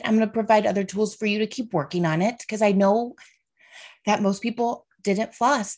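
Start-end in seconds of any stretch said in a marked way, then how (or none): none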